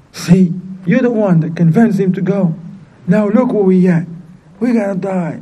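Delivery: retold reproach. Hungry family turns on the daughter, reproachful. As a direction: retell a hungry family's accusation.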